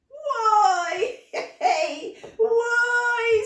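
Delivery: high, loud squeal with a laugh; delighted scream. Acted. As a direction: shriek with joy at the kiss. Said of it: "screamed Auntie May".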